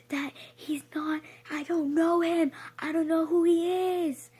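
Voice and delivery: screaming that she doesn't know him; panicked shout. Retold or imitated. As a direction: yell it as if fleeing in terror. Running back screaming for help.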